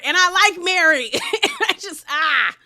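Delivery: breaking into laughter mid-sentence; laughing. Real, not acted. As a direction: giggle through the admission. Laughs while admitting she likes Mary.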